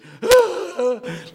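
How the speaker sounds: very high, loud put-on laugh; hammed-up high cackle in imitation; imitated outburst laugh. Imitating the professor enjoying his own wordplay, high loud laugh. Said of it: "like that".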